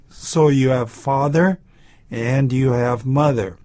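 normal talking voice

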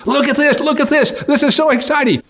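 very high, thrilled squeal; excited young man. Acted. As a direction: gush with childlike delight. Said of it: "And then he says".

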